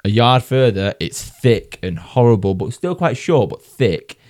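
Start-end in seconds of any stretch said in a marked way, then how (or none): none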